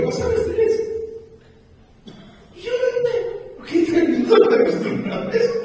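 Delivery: high, fast, loud preacher's cry; fiery preaching. Acted. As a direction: preach it at full force, racing. Preaching builds to full force. (predicación fervorosa, aguda y rápida)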